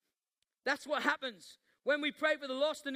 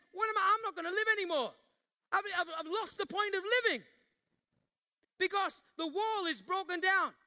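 anguished cry; 6 semitones higher than usual. Acted. Nehemiah cries out in distress.

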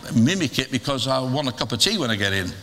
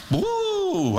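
a sudden high falsetto woo of delight. Real, not acted. Preacher reacting to the wife's answer with a high woo. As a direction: whoop high at the punchline.

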